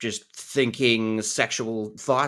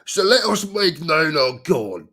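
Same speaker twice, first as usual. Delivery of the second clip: loud, higher, hammed-up British accent; Mary Poppins; 6 semitones higher, 7 dB louder than usual. Streamer mocking own British accent, loud hammy British voice. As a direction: belt it out in exaggerated British. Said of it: "Mary Poppins".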